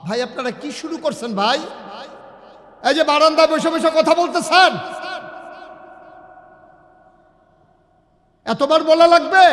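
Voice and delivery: loud, high-pitched telling-off; real exasperated scolding. Real, not acted. Scolds people chatting on the veranda.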